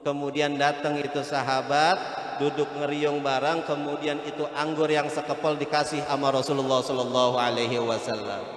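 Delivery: low, hushed storytelling; soft story narration. Acted. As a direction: draw listeners in, low and gentle. Quiet storytelling of the Prophet. (kisah dituturkan pelan dan lembut)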